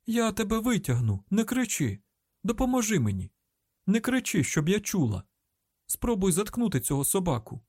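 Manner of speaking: high, insistent commands; strained high-pitched urging; urgent calling out. A narrator's reading. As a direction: call down over screaming and barking. Shouting instructions over screams and barking.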